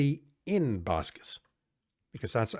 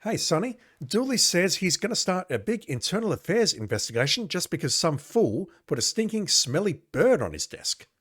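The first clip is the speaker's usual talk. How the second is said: higher, eager office gossip; higher chatty cop voice; gossiping coworker; 5 semitones higher than usual. Acted. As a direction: call Sonny over to share news. Coworker greets Sonny from game text.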